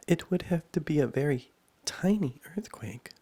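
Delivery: put-on high, loud girl character; Alice's voice. A narrator's reading. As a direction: pitch it up as young Alice.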